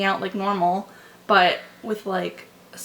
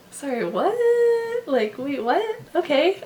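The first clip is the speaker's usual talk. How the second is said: high, rising, flustered what; stunned disbelief; 7 semitones higher, 4 dB louder than usual. Retold or imitated. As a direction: replay the shock, squeaking up. Retells shock at the kiss moment.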